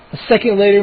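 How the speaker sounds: ordinary delivery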